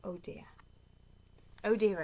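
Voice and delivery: ordinary delivery, nothing marked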